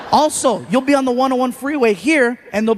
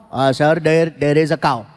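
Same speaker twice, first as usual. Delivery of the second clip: mock Indian driver; 6 semitones lower than usual. Driver's reply voiced.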